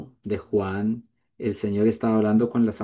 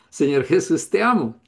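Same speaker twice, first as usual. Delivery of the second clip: higher, beaming with a smile; said smiling; 6 semitones higher than usual. Shown as a demonstration. Preacher showing his congregation that love makes you smile, said beaming. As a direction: let the smile come through the words. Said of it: "Todos están ustedes sonriendo".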